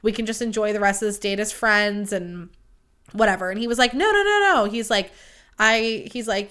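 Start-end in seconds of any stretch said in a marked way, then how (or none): 3.9-4.7 high, louder rushed no's; eager denial. Retold or imitated. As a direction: mimic him hurrying to object. He quickly rejects the idea.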